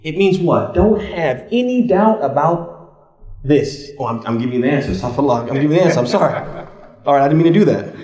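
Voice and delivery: ordinary delivery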